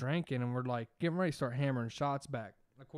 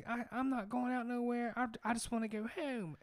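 high mock whiny girl; 10 semitones higher than usual. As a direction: whine like a stubborn teen. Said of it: "And then she was like".

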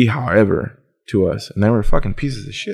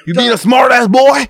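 imitating a customer, higher and louder; mock angry yell; 5 dB louder than usual.

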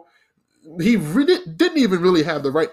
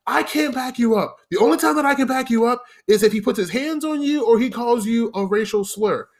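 higher-pitched scolding manager; retold yelling; 8 semitones higher than usual. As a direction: scold the new server from up high. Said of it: "immediately starts yelling at me".

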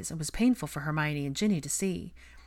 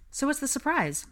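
tentative, higher-pitched question; hesitant; 10 semitones higher than usual. A narrator's reading. Hermione asks George about the surprise, hesitantly. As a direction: ask carefully, with a touch of hesitance. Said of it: "Hermione hesitantly asked George".

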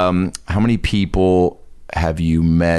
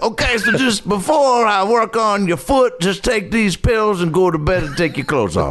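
Bill Cosby impression; 11 semitones higher than usual. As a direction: imitate a creepy sitcom doctor. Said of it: "Was he like".

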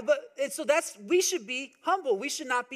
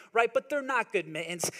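usual speaking voice

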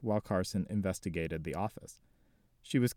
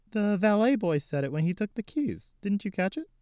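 put-on higher, quick nervous young woman; 11 semitones higher, 4 dB louder than usual. Jessie lies to Carson about the name, nervous light voice. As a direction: cover it lightly, a bit too fast.

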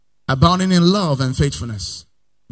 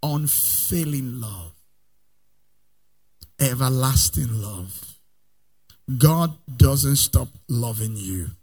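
soft, low, slow reassurance; tender quiet; 3 semitones lower, 5 dB quieter, slower than usual. Acted. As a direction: ease right down and comfort them.

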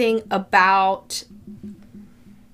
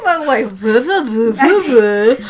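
slurred words. Real, not acted. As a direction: slur the syllables, tipsy and giggling. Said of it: "I'm slurring my words".

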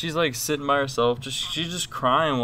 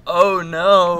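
loud, higher sympathetic "oh no"; retold dismay; 5 semitones higher, 9 dB louder than usual. Man retelling his reaction to a stranger's loss, loud dismay. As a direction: act out shocked sympathy, pushed up and loud. Reacting to news of lost daughter.

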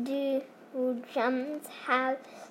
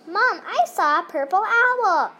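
put-on excited child voice; 8 semitones higher, 6 dB louder than usual. Child voice in story.

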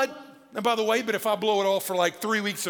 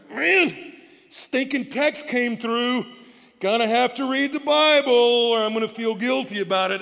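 mock grudging complaint; 4 semitones higher, 8 dB louder than usual. Preacher mocks grudging Bible reader.

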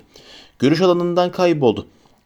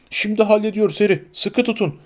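calling out; 6 semitones higher than usual. A narrator's reading. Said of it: "diye seslendi Hagrid".

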